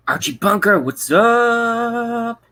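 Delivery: excited greeting. Real, not acted. Greeting a chatter by name.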